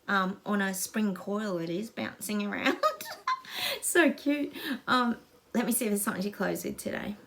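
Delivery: ordinary talk, nothing marked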